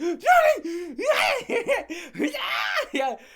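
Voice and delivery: loud, high mock shrieks; hammed-up freak-out screams; imitated screaming. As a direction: wail and shriek, imitating someone flipping out. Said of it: "he started flipping out".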